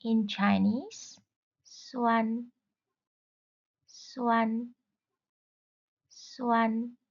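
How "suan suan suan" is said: "Suan" said slowly on a level tone; teacher modelling "Suan" with an even pitch; Mandarin pronunciation. Shown as a demonstration. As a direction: say "Suan" as a clear, held level syllable. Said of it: "How do we say sour".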